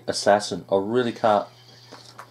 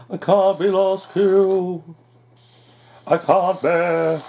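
slow, higher, pleading whine; desperate drawn-out plea not to die last; panicked whine; 7 semitones higher, slower than usual. Real, not acted. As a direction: stretch it out like you're begging the game.

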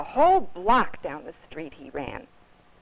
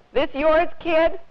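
put-on a loud, higher friendly big boy; 9 semitones higher, 6 dB louder, slower than usual. A narrator's reading. Big boy hands the cap back to Robert, hearty and loud. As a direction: call out to the little kid, hearty.

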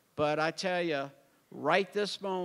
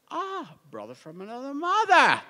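excited exclamation; 9 semitones higher, 10 dB louder than usual. Retold or imitated. Retold joyful recognition of namesake.

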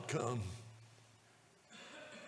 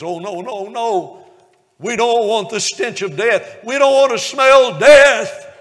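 pleading protest; 9 semitones higher, 18 dB louder than usual. Retold or imitated. Sister protests opening the tomb.

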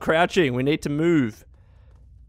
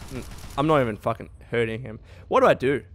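ordinary talk, nothing marked

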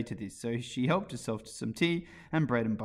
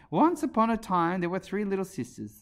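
plain reading voice

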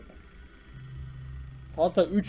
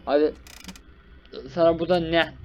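ordinary talk, nothing marked

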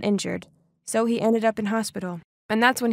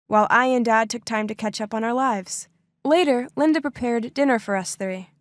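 ordinary delivery, nothing marked

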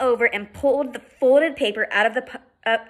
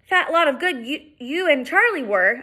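near tears; 6 semitones higher than usual. Acted. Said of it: "I cried, feeling near tears".

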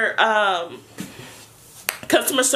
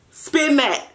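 higher, playful; 6 semitones higher than usual. Shown as a demonstration. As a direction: hype it up the second time.

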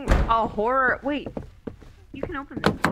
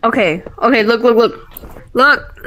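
being chased; 9 dB louder than usual. Real, not acted. Urgent while chased.

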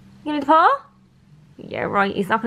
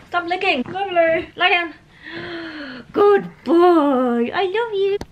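higher cooing to the pug; high dog talk; 7 semitones higher, 4 dB louder, slower than usual. Real, not acted.

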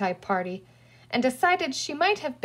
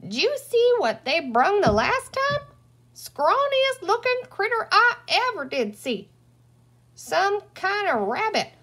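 put-on withered old woman; 5 semitones higher than usual. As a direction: squeak it out like a dried-up old lady. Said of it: "a dry, withered-up little woman".

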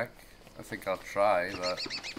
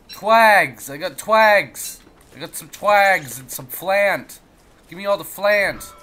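silly goblin voice; 6 semitones higher, 8 dB louder than usual. Acted.

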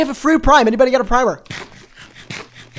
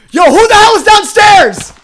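high, loud, drawn-out yell downstairs; startled shout; 10 semitones higher, 9 dB louder, slower than usual. Real, not acted. Streamer yells at an unknown player in his house, startled shout. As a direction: shout down at the intruder.

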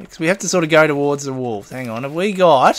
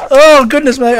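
flustered exclamation; 8 semitones higher, 10 dB louder than usual. Real, not acted. After fumbling the wolf fight.

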